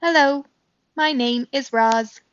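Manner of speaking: lightly voiced robot introducing herself; robot greeting. A narrator's reading. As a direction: speak like a gentle machine. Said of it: "the robot spoke to the animals".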